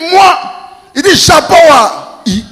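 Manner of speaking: high, loud shouted retort; shouting back. Retold or imitated. Brother yelling back across the road, high shout.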